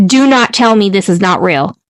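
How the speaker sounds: normal talking voice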